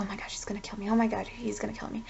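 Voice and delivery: hushed, near-whispered mock panic. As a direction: whisper it like someone pretending to dread the worst. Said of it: "they're, like, over there dying".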